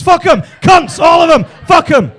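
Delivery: high, very loud bursts of outrage; ranting shout. Acted. Comedian dismissing avocado-eating London parents, ranting shout. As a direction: explode into a comic tirade. Comic outburst against posh parents.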